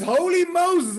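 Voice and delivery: high, loud exclamation; loud command. Acted. Rider halting the horse with a loud high exclamation. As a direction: call it out to halt the horse.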